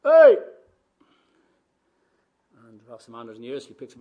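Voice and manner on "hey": sudden loud, high call; sharp shout to get attention; shout upstairs. Acted. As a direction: holler up to a window. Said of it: "he shouts to the man upstairs".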